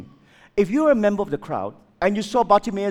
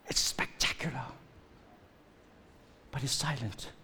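hushed whisper; 7 semitones lower, 13 dB quieter than usual. Real, not acted. Preacher whispering the point to the congregation. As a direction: drop to a whisper on spectacular and silent.

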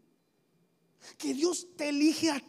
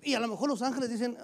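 usual speaking voice

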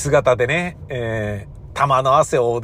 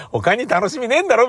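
mock sneer; 8 semitones higher than usual. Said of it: "みたいなこと言ってる".

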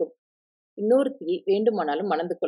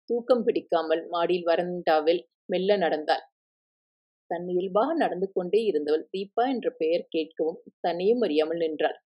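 usual speaking voice

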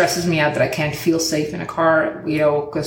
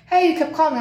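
a raised, lightly voiced car owner; higher pitch for his line; quoting the car owner; 9 semitones higher, faster than usual. The car owner calling back, lightly voiced higher. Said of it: "He's like".

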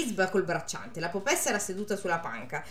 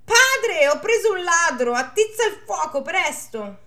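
high, loud alarmed shout; shouted at the top of his lungs; 8 semitones higher, 7 dB louder than usual. Acted. Farmhand shouting to the priest about a thief, loud. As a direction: yell for the priest to come. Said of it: "gridò a squarciagola".

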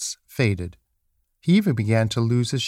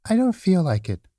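high little rabbit voice; reluctant small rabbit, pitched up; little toy rabbit; 8 semitones higher than usual. A narrator's reading. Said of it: "said the rabbit".

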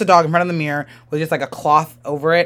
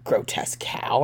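a sly, voiceless whisper at her back; whispered insult; 6 semitones lower, 9 dB quieter than usual. Acted. Mirror whispers an insult at the turning wife. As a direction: breathe it out like a hidden voice.

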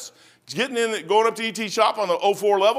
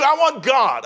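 "I want God" again, a bit less loud, still fervent; repeated declaration; 5 semitones higher, 6 dB louder, slower than usual. Acted. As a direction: restate "I want God" firmly.

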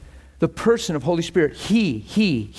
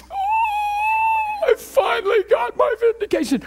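mock triumph; slower than usual. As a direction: gloat it out like a cartoon winner. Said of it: "and I wasn't going".

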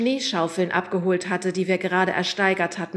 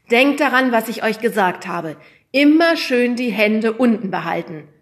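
stern reminder; 4 semitones higher, 10 dB louder than usual. A narrator's reading. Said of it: "erinnerte uns der Lehrer".

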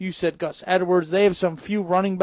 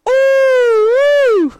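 excited ooh; 7 dB louder than usual. Real, not acted. Surprise at the shot glass.

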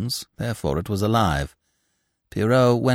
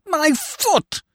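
very high, put-on yelp; pained complaint. A narrator's reading. Scaramouche dropping back after his fall, pained yelp. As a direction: cry out high over the hurt. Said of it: "he complained".

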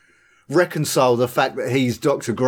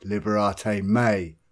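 slow quote; 7 semitones lower, 6 dB quieter, slower than usual. Retold or imitated. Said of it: "And then Miller goes".

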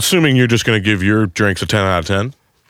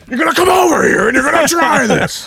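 pushy shout. Pretend. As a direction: shout it at passers-by. Pushy salesman calling out.

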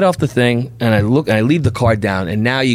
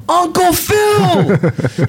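much higher, long drawn-out happy shout; joyful shout; much slower than usual. Retold or imitated. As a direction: call out with huge delight. Greeting the relative on phone.

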